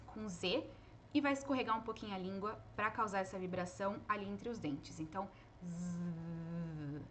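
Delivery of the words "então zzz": long slow soft "zzz" with the tongue between the teeth; buzzing z. Shown as a demonstration. Teacher sustaining the voiced th buzz, slow and soft. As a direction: drag out the buzz in "zzz" slowly. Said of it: "esse é o som".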